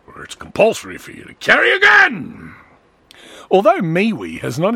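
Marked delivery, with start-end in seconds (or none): 0.1-2.6 mock gun-loving American. Mocking US gun culture.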